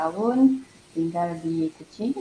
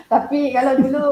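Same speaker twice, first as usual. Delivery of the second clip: laughing; 7 semitones higher, 8 dB louder than usual. Real, not acted. Laughing while recalling childhood dream.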